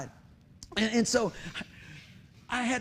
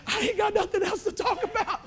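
very high, comic squeak of desperation; mock terrified confession; 13 semitones higher than usual. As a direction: admit in comic terror that you're empty. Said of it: "I was just terrified".

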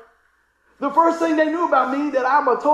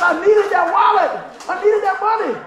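louder and higher, half-laughing; emphatic shout; 8 semitones higher, 7 dB louder than usual. Retold or imitated. Friend to the pastor about the wallet, loud and laughing. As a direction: belt out the need with a laugh.

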